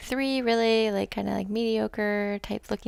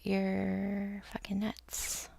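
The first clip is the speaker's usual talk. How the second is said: slow whispered mock disbelief; 6 dB quieter, much slower than usual. Viewer reacting to her starting over, slow whisper. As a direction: breathe it out slowly, half joking.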